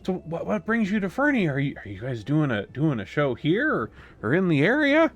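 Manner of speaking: high, eager, fan-like chatter; starstruck little villager. Acted. Burnbomon asks the heroes about their visit, high and eager. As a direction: gush like a shy fan meeting his idols. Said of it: "Burnbomon is like".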